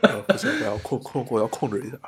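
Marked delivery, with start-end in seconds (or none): none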